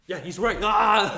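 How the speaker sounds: fired-up student shouts back at coach. Retold or imitated. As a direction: yell it like you just got fired up. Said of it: "I'm like".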